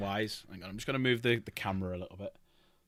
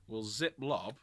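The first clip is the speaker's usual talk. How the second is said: ordinary talk, nothing marked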